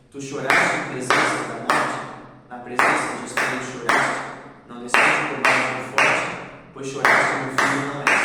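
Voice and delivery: loud pounding chant on each beat; drumlike clapped recitation. Shown as a demonstration. Teacher claps the rhythm of the father's curse, loud. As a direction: beat out the verse like a war drum. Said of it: "que eu vou fazer agora".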